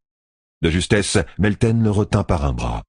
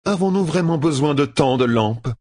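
higher, lofty woman's voice; haughty woman; 8 semitones higher than usual. A narrator's reading. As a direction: speak as if from a throne. Aes Sedai complaining.

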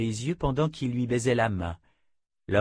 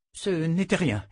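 plain reading voice

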